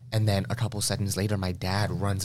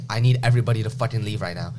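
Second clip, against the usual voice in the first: angry demand; 2 semitones higher, 7 dB louder, faster than usual. Retold or imitated. Demanding the crowd leave.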